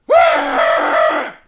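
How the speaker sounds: high, loud wordless shout; imitated angry yell. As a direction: bellow it like an enraged caller. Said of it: "He literally fucking sounded like that".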